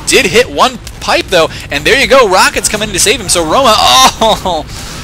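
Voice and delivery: high, loud commentary burst; urgent, high-energy shoutcast; excited play-by-play. Real, not acted. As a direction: call the action at full pitch, ending in a long groaned oh. Live play-by-play of rescue attempt.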